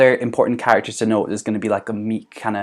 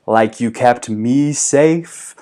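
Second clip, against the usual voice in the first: very slow, drawn-out ghostly taunt; haunt him from the back of the room; slow eerie ghost voice; ghostly accusation; 3 semitones higher, 5 dB louder, much slower than usual. Retold or imitated. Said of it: "a voice from the back".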